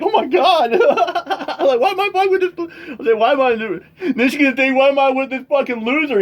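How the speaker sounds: high, laughing mock girlfriend voice. Imitating the scared girlfriend of the yelling streamer. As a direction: play her exasperated and fed up. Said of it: "she probably gonna have to go outside like".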